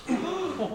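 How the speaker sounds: a sharp gasp of dismay; shocked gasp. Acted. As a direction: gasp as if caught out. Shocked at own mean words.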